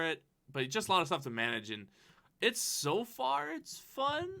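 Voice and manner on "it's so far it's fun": drawn-out, unsure rising tone; hesitant. Real, not acted. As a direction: say it like you're not quite sure. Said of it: "I say it with, like, "Uh," kind of a thing".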